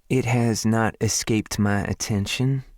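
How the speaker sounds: lightly voiced southern woman, dry. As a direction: keep the smile, deadpan. Her dry reply.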